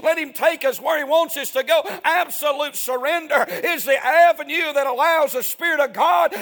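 loud, fervent preacher's call; preaching at full force. Acted. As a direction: drive it home to the congregation.

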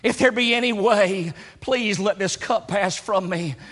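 Jesus in anguish pleading with his Father; anguished prayer. Acted. Preacher voices Jesus praying in Gethsemane.

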